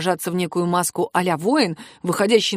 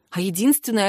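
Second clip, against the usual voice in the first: ordinary delivery, nothing marked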